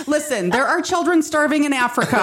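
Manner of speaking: lightly voiced stern German parent. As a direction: lecture like a strict parent. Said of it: "the German parent".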